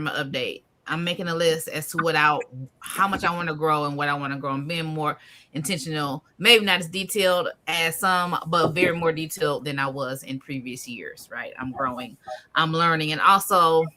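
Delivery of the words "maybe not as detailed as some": ordinary talk, nothing marked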